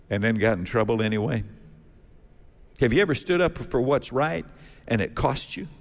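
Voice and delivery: normal talking voice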